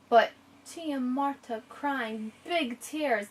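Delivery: Tia Marta crying big tears, drawn out slowly with weight on big; slow emphasis on big tears. Shown as a demonstration. Teacher showing how description paints the picture, slow emphasis. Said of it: "Now do you see?".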